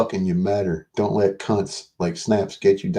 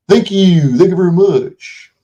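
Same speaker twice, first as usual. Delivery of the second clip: much higher, louder chirpy thank-you; louder, higher mock-gushing gratitude; high playful thanks; 10 semitones higher, 7 dB louder than usual. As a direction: sing out the thanks in a squeaky put-on voice.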